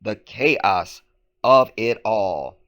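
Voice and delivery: loud, drawn-out "chaos of it all"; mimicked reporter cadence. Host parodies the reporter's cadence for listeners, mock-dramatic emphasis. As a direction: hit CHAOS hard, then stretch "of it all". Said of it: "the cadence of her speech there".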